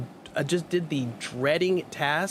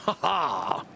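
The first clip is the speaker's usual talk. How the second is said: long villain cackle; put-on villainous ha-ha cackle; evil laugh. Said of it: "I will do my evil laugh now".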